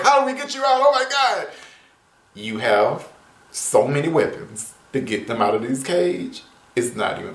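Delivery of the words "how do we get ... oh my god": high, hammed-up fake panic; mock the Rangers' overblown panic. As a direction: Rangers acting like a cage is a big deal, mock panic. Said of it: "they were acting like it was such a big deal".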